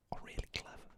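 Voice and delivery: ordinary talk, nothing marked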